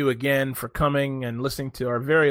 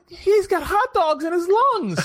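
much higher, slow astonished cry; incredulous exclaim; 3 dB louder, slower than usual. Retold or imitated. Describing a video to friends, astonished high exclaim. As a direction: gasp it out in disbelief. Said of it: "I'm like".

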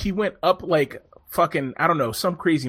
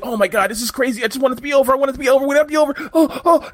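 imitating a winded rider, pitched higher and louder; mock breathless rider; 10 semitones higher, 5 dB louder, much faster than usual. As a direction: pant it out like a rider at his limit. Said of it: "And he's breathing hard".

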